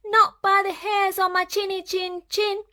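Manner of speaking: high, squeaky piglet defiance; defiant piglet yell. Acted. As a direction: yell back like a cheeky little pig. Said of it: "yelled Horace".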